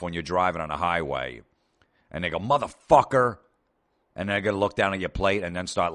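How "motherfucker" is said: angry driver yell. Retold or imitated. Driver cut off on highway.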